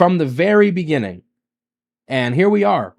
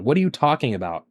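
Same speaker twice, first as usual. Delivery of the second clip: ordinary delivery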